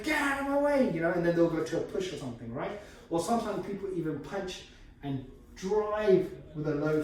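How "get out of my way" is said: mock demand pitched way up; pushy attacker. Instructor voicing a pushy opponent for students: a high, mock demand.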